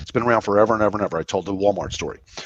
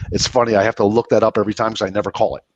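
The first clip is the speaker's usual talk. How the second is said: ordinary talk, nothing marked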